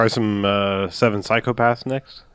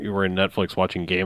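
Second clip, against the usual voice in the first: ordinary talk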